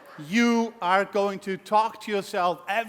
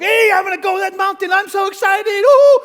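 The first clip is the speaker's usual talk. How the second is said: much higher and louder, bursting with excitement; giddy newcomer; 10 semitones higher, 10 dB louder than usual. Retold or imitated. Newcomer starting the business, overexcited squeal. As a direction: squeal like an eager beginner. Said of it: "we look at the mountain and go".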